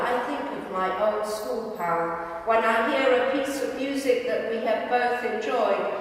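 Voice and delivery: ordinary delivery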